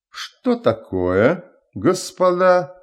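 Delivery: a storybook tsar half awake; yawning. A narrator's reading. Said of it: "зевая". (слегка зевая, сонно)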